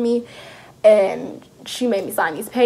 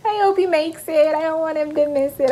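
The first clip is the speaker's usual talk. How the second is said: mock tearful; 5 semitones higher than usual. Retold fear after he hangs up.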